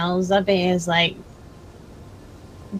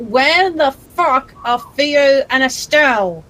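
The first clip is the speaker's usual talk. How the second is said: shouting; 5 semitones higher, 4 dB louder, slower than usual. Acted. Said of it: "like, mid-shout".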